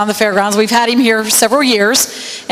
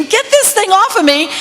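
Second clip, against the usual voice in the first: retold scream; 10 semitones higher than usual. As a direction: imitate the girl's panicked cry, not at full volume.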